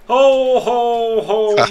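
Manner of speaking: belly-laugh it out like Santa Claus; jolly Santa ho ho ho. Acted. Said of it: "Santa Claus is here".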